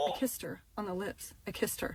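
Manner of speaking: quiet, sinking repeat of an embarrassing admission; hushed confession. Real, not acted. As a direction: drop your voice, mortified.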